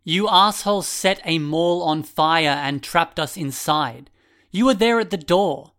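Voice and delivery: loud, high accusing shout. A narrator's reading. Glasses boy shouts at the masked boy, loud. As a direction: yell the accusation at him. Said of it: "the boy with the glasses shouted".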